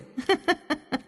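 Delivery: high, light teasing giggle; mocking giggle. A narrator's reading. Suhra laughs at Majeed's boast, mocking giggle.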